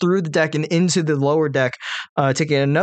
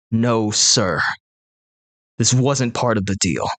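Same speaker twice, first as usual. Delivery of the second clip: hippo; 4 semitones lower, slower than usual. Acted. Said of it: "and says".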